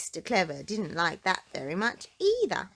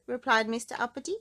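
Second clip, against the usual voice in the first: ordinary delivery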